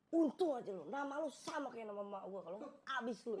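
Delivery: high-pitched acted threat; threatening robber. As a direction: let the hostage off with a warning. Maling melepaskan istri dengan ancaman. (mengancam dengan nada tinggi)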